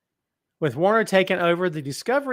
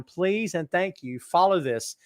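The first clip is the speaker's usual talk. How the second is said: ordinary talk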